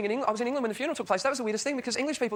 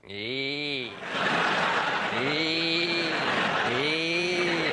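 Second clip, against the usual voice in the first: long, held "ee" like a detector tone; mock radioactivity alarm; imitated Geiger counter squeal; much slower than usual. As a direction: sustain one droning "ee". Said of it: "watching a Geiger counter measure radioactivity".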